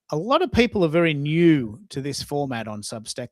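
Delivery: normal talking voice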